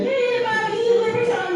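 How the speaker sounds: put-on very high kid shout; screaming kids. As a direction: yell it like a crowd of kids.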